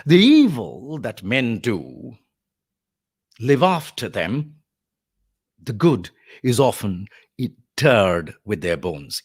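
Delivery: slow, measured declamation; Mark Antony orating. Acted. Lecturer as Mark Antony on good and evil, slow oration. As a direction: stretch each phrase out as a public speaker. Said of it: "begins Mark Antony".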